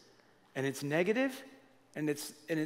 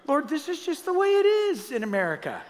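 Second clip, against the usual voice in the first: laughing excuse; 10 semitones higher, 5 dB louder than usual. Retold or imitated. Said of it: "I was like".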